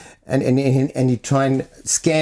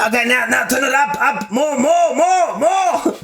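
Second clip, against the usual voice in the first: climbing mock shout of more, more; excited urging; 11 semitones higher, slower than usual. Uncle on the toilet urging his nephew to open the tap, building excited urging. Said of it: "and he's going".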